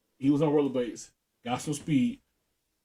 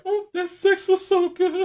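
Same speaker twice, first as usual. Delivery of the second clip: much higher, swooning falsetto woman; mock woman's voice; 6 dB louder than usual. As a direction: imitate her gushing in a high voice. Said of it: "she was like".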